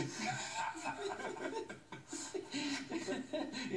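high helpless giggling; long giggle fit. Real, not acted. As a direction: crack up again. Laughing fit on air.

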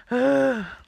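loud weary sigh; heavy sigh. Real, not acted. Sighing to viewers about the money spent. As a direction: let out a big sigh over the bill. Sighs over the spending.

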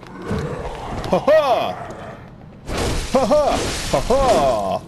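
exclaimed "ha ha". Real, not acted. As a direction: crow it out mid-fight. Crows during a game fight.

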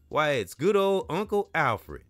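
loud, high mocking taunt; taunting bully. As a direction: jeer at him like a bully. Bully taunting Alfred in story.

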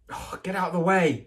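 put-on boy losing patience with a pest; snapping. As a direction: brush him off sharply. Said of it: "snapped Ron".

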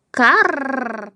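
a rolled, cawing "karr"; crow caw. A narrator's reading. Narrator opens the crow's story, crow caw. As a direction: imitate a crow's caw with a long trilled r.

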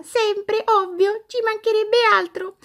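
high, chirpy toy doll voice; squeaky doll voice. Acted. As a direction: play a cheeky little doll.

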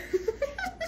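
high breathy giggle; little giggle at yourself. Real, not acted. Laugh after intro.

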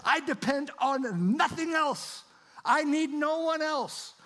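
fervent preaching. Acted. Pastor exalting God's self-existence to his congregation, fervent preaching. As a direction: preach it with rising fire.

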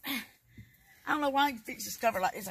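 ordinary talk

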